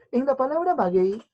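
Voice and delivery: ordinary talk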